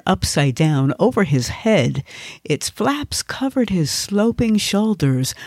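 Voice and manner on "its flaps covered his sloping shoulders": ordinary delivery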